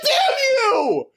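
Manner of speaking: very high, strained mock-furious howl; outraged yell. Cheated viewer cursing the show's writers, outraged yell. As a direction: yell in hammed-up outrage, stretching the last words. Acts out fury at the show.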